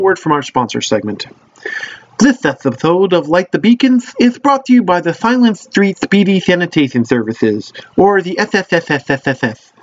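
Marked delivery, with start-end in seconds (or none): 2.2-7.7 upbeat commercial announcer; ad read voice. Acted. Mock sponsor ad read. 8.0-9.7 long hissing s sounds. Acted. Hissing the S initials.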